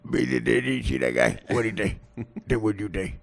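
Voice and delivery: low Italian-American street talk; slurred, low Italian-guy chatter; Italian guy impression. Italian guy talking with blacks, Italian-American accent. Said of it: "learn how to talk like him".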